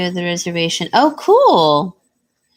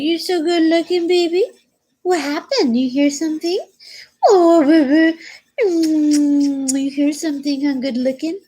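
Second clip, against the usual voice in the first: higher, teasing pet voice; 6 semitones higher than usual. Real, not acted. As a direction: coo lovingly at the dog. Cooing at her dog.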